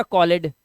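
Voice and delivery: कॉलेड in two syllables, call-ed; wrong reading. Shown as a demonstration. Teacher adding ed as learners might, wrong two-syllable reading. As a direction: sound the e in कॉलेड, ending "lead". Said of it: "इसे कॉलेड नहीं पढ़ते".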